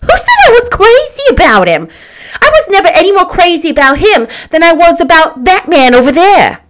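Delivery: very high, loud indignant denial; scandalized, furious rebuttal; incredulous outrage. A narrator's reading. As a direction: protest at the top of your voice. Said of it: "cried Myrtle incredulously".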